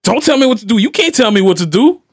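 high-pitched retold teenage defiance; defiant stepdaughter. Stepdaughter talking back to her stepfather, mimicked defiance. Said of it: "she said it with that".

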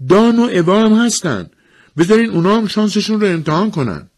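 very high, loud protest; acted shrieking demand; yelling. A narrator's reading. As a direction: yell in desperate objection. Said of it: "داد زد".

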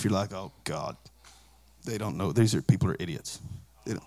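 a sheepish aside to the congregation; muttered aside. Retold or imitated. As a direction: mutter it under your breath. Muttered aside about online critics.

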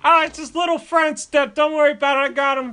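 high, loud dad calling to his wife. Retold or imitated. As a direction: holler through the house in a strained high voice.

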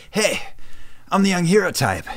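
high, bright heroic call; rally the team like an anime hero; young hero voice. Retold or imitated. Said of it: "being cast as".

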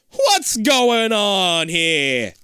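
very slow, high, loud spooky drawl; spooky drawn-out voice. Acted. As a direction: stretch it out like a haunting. Dramatic spooky reading.

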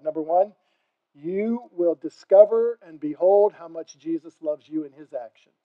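normal talking voice

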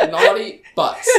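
high, drawn-out, laughing naughty phrase; giggly and loud, hamming up the naughtiness; mock naughty. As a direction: savour the silly rude words slowly. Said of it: "you gotta say it like that".

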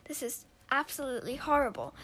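put-on a fussy boy ordering people out; disgusted protest. Boy chasing his sister from his room, disgusted protest. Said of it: "He's like".

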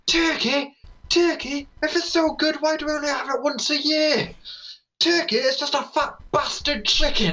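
high-pitched ranting character voice; comic dad character. Retold or imitated. Teenager's dad at the Christmas special, comic rant. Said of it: "he's just sort of like".

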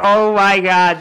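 loud, high shocked exclamation; shocked oh my God. Retold or imitated. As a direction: blurt it out in disbelief. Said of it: "And I was like".

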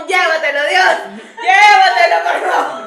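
hammed-up begging to God; mock prayer. Friend mimics pleading with God, laughing.

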